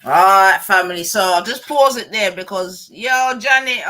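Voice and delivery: loud, hyped greeting to the stream; calling out loudly. Real, not acted. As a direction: boom it out to the whole chat, family-style. Streamer calls out to his viewers.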